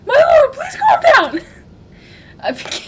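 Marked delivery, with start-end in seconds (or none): 0.1-1.4 very high, loud squeaky shout; pleading attendant. Acted. Phil begging the lord to calm down, in a high hammed-up shout. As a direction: shriek a hammed-up plea.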